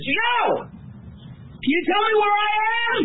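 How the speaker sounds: high, drawn-out call from a balloon. Acted. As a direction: call down to someone far below. Lost balloonist calls to ground.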